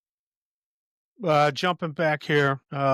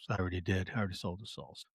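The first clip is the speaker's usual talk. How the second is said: low, quiet, grave delivery; deadly serious; 4 semitones lower, 10 dB quieter, faster than usual. Retold or imitated. Ringo answering Curly Bill, quiet and grave. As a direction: drop it low like a dark confession. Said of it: "serious as a heart attack".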